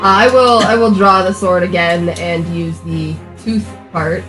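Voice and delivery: ordinary talk, nothing marked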